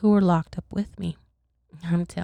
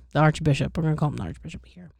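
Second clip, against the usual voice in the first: usual speaking voice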